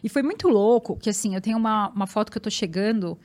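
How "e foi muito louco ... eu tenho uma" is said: normal talking voice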